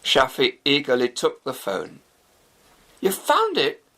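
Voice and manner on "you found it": high, eager rush; hopeful and thrilled; excited question. A narrator's reading. Shafi on the phone to police, excited. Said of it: "he asked excitedly".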